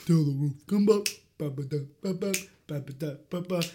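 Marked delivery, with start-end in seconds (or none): none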